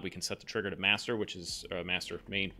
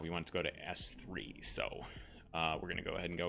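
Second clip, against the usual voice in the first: whispering; 4 semitones lower, 7 dB quieter than usual. Real, not acted. Whispering mid-stream.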